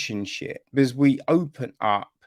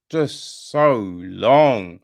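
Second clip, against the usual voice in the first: drawn-out exasperation; 7 dB louder, much slower than usual. Shown as a demonstration. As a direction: stretch "just so long" very slowly and louder. Repeats "so long" for effect.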